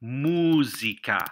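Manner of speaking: Música drawn out syllable by syllable; Música stretched out for learners; slow word model. Shown as a demonstration. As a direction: say Música slowly as mú-si-ca. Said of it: "just like that".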